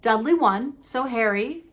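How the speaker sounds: usual speaking voice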